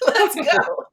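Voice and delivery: laughing through the words; words bubbling with laughter. Real, not acted. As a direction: giggle it out as you wrap up. Host breaks off laughing.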